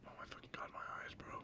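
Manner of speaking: hushed unvoiced whisper. Real, not acted. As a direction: breathe it out with no voice. Same exclamation whispered after.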